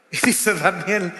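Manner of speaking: ordinary delivery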